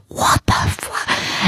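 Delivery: slow unvoiced whisper, stunned; a shocked whispered aside. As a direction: breathe it out in disbelief. Inner thought, shocked aside.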